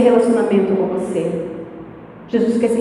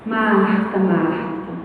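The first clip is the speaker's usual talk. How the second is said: soft, lower, tender calling of a name; gentle and sweet; 4 semitones lower, 6 dB quieter, much slower than usual. Retold or imitated. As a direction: say her name gently, fading. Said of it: "E foi assim, doce assim". (chamando Marta com doçura, mais baixo)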